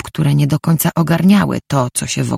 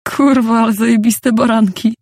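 high-pitched mocking holler; loud jeering shout; 9 semitones higher, 3 dB louder than usual. A narrator's reading. Man jeers at her sheep pajamas, loud shout. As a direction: call it out loudly, much higher, making fun. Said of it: "krzyknął donośnie".

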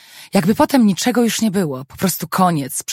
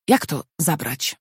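ordinary delivery, nothing marked

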